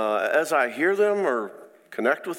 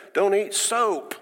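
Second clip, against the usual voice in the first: parental caution; 8 semitones higher, slower than usual. Retold or imitated. God as caring parent.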